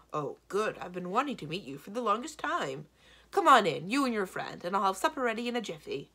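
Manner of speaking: loud, delighted hostess; suddenly welcoming. A narrator's reading. Giantess welcomes the long-awaited princess, suddenly hearty. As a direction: burst into hearty, eager hospitality. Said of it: "in an entirely different manner".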